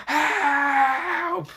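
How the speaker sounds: high piercing cry for help; loud scream for help. Acted. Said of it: "screaming out really loud".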